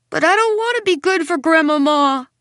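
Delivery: put-on high, loud child's protest; shouting. A narrator's reading. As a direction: yell it out, refusing. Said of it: "Now I'm shouting".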